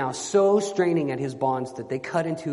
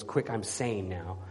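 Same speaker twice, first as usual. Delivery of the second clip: low, slow and softer; clear tone of voice; 6 semitones lower, 7 dB quieter, slower than usual. A narrator's reading. Knight claiming he is sane, clear lowered tone. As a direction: come back down into a clear, composed tone. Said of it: "clear tone of voice".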